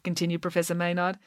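ordinary delivery

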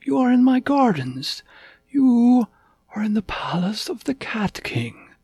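high-pitched, deliberate royal announcement; stately cat king. A narrator's reading. Cat King tells lost puppy where he is, high and stately.

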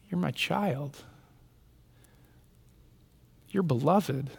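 put-on very soft, intimate father and child voices; hushed tender exchange. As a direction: speak it softly like a parent at a bedside.

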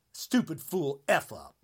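ordinary talk, nothing marked

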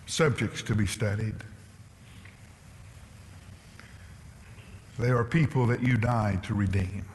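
prayer. Acted. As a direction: pray humbly over the congregation. Pastor leads closing prayer.

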